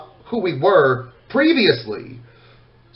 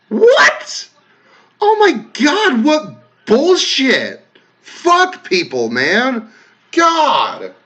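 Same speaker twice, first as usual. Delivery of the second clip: high, drawn-out indignant disbelief; real outrage; 9 semitones higher, much slower than usual. Real, not acted. Creator hearing a viewer was harassed, outraged. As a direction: exclaim in appalled disbelief, stretching the words.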